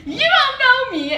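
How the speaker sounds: high, loud mock-sassy squawk; hammed-up sassy retort; sassy outburst. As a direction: belt it out high with exaggerated sass. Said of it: "My sassy self was like".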